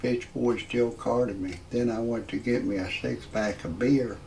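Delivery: usual speaking voice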